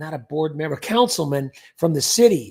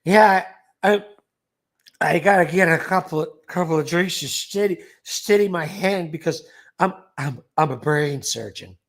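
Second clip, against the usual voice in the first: put-on stumbling comic drunk; mock drunk, halting toward the punchline; Foster Brooks. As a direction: slur and repeat words like a tipsy man. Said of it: "same, same situation".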